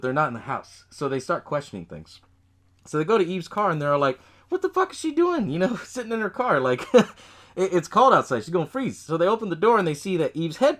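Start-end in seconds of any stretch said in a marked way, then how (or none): 4.5-6.6 mock bafflement. Friends baffled at Eve's car.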